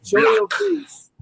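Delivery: demanding shout. Real, not acted. Demanding the camera on.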